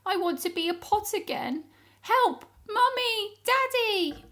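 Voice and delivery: high, frightened cries for help; loud, high squealing for mummy and daddy; panicked wail. Acted. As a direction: wail like a scared child. Jelly pot panics, calls for help.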